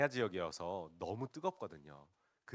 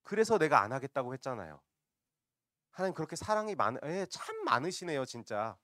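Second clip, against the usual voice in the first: raised, loud sarcastic complaint; acted sarcastic outburst; blatant sarcasm; 5 semitones higher, 6 dB louder than usual. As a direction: throw the praise back mockingly, drawing it out. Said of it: "아주 노골적으로 비꼬고 있는 장면이".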